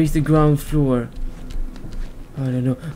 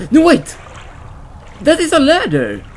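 excited discovery; 9 semitones higher than usual. Real, not acted. Gamer spots a ladder, sudden high excited cry. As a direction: burst out on spotting it.

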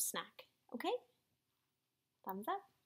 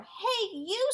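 high, loud grouchy hail; brash storybook heckle; acted calling out; 9 semitones higher, 6 dB louder than usual. A narrator's reading. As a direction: call out a challenge, pitched well up. Grouchy ladybug accosts a sparrow.

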